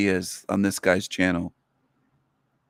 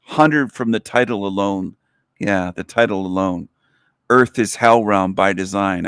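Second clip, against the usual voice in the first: normal talking voice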